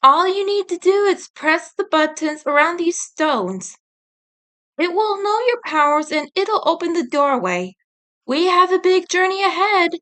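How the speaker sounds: lightly voiced fairy guide. Rin explains portal.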